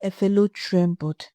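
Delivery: ordinary delivery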